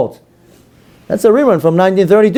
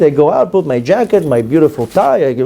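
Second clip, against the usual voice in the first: normal talking voice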